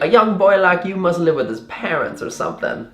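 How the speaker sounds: high put-on Southern lady voice; Southern driver's chatty lilt; Southern accent. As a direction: imitate a bubbly Southern woman. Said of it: "really bubbly and talkative and Southern".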